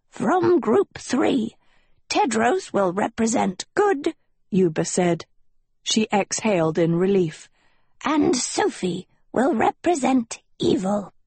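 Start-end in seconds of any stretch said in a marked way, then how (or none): 0.1-4.1 put-on high, slow old gnome announcing; a small gnome calling out solemnly. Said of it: "Then Yuba stepped forward". 8.0-11.1 a small gnome calling out solemnly. Acted. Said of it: "Then Yuba stepped forward".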